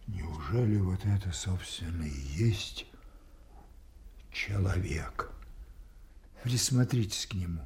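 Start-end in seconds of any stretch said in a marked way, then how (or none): 0.0-5.3 sinking to a whisper; awed whisper. Acted. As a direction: breathe the question. Lear's awed realisation.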